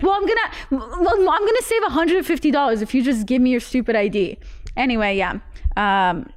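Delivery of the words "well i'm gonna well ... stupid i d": high-pitched protest to a sibling; mock sibling bickering, pitched up; acted-out arguing. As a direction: plead your case in a higher voice. Retells own argument with annoyed sister.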